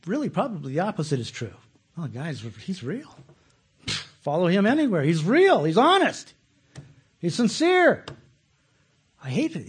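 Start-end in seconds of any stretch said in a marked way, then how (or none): 2.0-8.0 mock admiring praise. Followers praising an honest leader.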